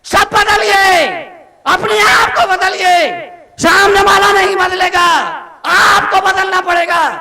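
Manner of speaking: high, forceful sermon shout; preaching at full force. Real, not acted. Monk urging families to change themselves, full-force preaching.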